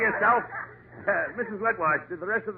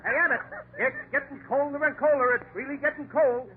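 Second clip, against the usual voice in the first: ordinary delivery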